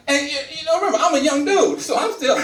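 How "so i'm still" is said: ordinary talk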